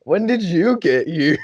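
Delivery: high, slow mock bewilderment; stoned surprise. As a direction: act out a dazed, very high double take. Stoned, startled to find friend there.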